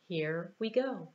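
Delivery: plain reading voice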